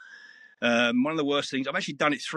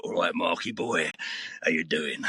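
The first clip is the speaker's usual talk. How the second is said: put-on old gangster; 5 semitones lower than usual. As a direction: play an old gangster reading his first line, deep and unhurried. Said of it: "He's an old gangster".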